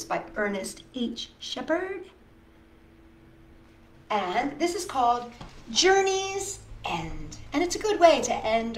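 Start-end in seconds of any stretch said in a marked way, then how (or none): none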